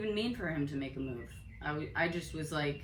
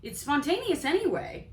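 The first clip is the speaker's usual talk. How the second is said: mock retort; 9 semitones higher, 3 dB louder than usual. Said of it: "And I'm like".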